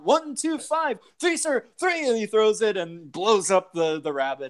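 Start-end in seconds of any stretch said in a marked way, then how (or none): 0.0-2.1 mock King Arthur counting. King Arthur miscounting, pulling grenade pin.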